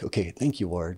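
hushed little private thanks; under the breath. Shown as a demonstration. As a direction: say it privately, barely voiced to yourself. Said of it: "kinda under our breath".

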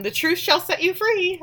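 high, airy preachy voice; mock-solemn proverb, way up high; lofty mock. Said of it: "And says".

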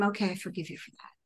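a quick whispered "I forgive you" while walking; whispered aside. Retold or imitated. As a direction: breathe it out under her breath quickly.

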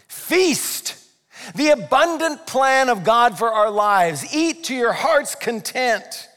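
high, loud, drawn-out preaching; exuberant proclamation. Real, not acted. Preacher urging people to eat to their heart's content, exuberant. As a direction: proclaim it slowly with full enthusiasm.

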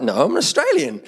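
high-pitched mock Australian protest; exaggerated Australian accent. Band member answering East Coast locals, high mock-Australian protest. Retold protest at being called English.

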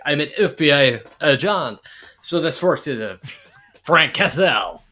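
loud, mangled British attempt at an American accent; hammed-up bad American accent with British vowels; Colin Salmon. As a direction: imitate a Brit botching American, slowing down heavily at the end. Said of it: "sort of imitating an American".

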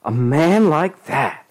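high, slow, outraged exclamation; appalled woman. A narrator's reading. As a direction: draw it out in high horror. Claudette appalled, family argument.

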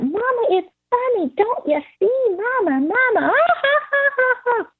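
put-on very high child voice breaking into ha-ha; imitated laughing toddler. Toddler insisting to mama it's funny, mock laughter. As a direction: squeal like a tickled little girl, then mock cackle. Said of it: "she just kept saying that".

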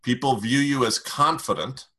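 ordinary delivery

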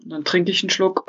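ordinary delivery

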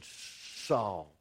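low, soft calling of the name; a hushed divine call. Acted. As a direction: call his name low and quiet, as if from far off. God calls Saul from the light.

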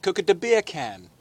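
put-on Jamaican accent. Shown as a demonstration. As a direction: slip into a mock Jamaican accent. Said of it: "if I wanna do Jamaican".

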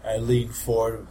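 usual speaking voice

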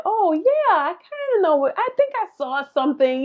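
high, hesitant voice imitating vague strangers; vague unsure people. Imitating people who half-knew Etsy, high and unsure. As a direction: mimic someone who barely knows Etsy. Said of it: "people were like".

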